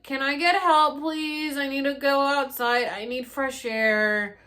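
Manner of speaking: loud, high pleading call; urgent plea for help. Retold or imitated. Calling to helpers for fresh air, a loud high plea. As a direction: call out for help, drawing out the end.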